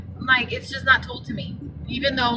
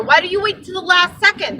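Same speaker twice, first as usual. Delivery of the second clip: higher, indignant why do you wait till the last second; mock exasperated question; 6 semitones higher, 4 dB louder than usual. Complaining about a driver's late compliment, indignant and higher. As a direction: scold him like he should have known better.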